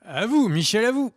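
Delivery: présentateur télé parodique, plus fort; mock TV anchor. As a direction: joue le journaliste qui rend l'antenne. Répond façon duplex télé. (voix de présentateur de JT, plus forte)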